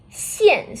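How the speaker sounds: "Xian" blended into one syllable with a falling pitch; "Xian" demonstrated with a clear fall; fourth tone. Shown as a demonstration. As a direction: start "Xian" high, then drop it. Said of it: "This is the fourth tone here".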